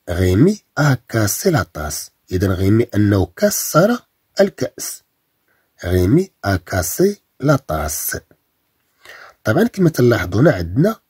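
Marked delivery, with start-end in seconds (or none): 0.1-2.1 slow, clear French sentence; slow dictation pace; slow model reading. Shown as a demonstration. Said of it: "model reading". 5.8-8.2 slow, careful classroom French; each word slowly; slow dictation pace; slow model reading. Shown as a demonstration. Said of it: "model reading".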